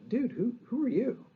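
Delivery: high, incredulous dismissive voice; a puzzled, mocking who-are-you; baffled brush-off. Stalwarts brushing off an unknown office seeker, mock baffled. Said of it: "the response of the stalwarts were like".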